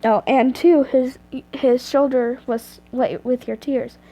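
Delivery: ordinary delivery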